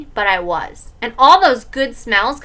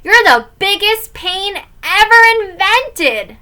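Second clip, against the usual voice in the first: loud, high, drawn-out yell; exasperated kid's yell; 10 semitones higher, 7 dB louder, slower than usual. Acted. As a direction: yell it at your brother, slowly. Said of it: "I yelled".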